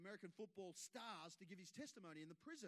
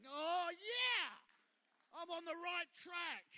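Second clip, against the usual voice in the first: much higher and louder, thrilled; excited; 9 semitones higher, 13 dB louder, slower than usual. Pretend. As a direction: burst out with joy at the news. Imagined Jesus reacting.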